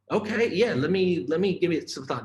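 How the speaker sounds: eager reply. Retold or imitated.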